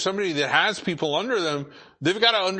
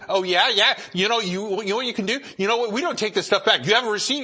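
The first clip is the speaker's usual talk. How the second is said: playfully picking a quarrel, pitched higher; mock clerk fight; 6 semitones higher, faster than usual. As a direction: ham up a clerk itching for a fight. Clerk picks a fight on purpose.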